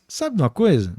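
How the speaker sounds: ordinary talk, nothing marked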